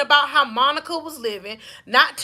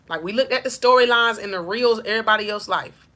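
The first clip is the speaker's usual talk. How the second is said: whisper; 3 semitones lower, faster than usual. Real, not acted. Aside to the audience.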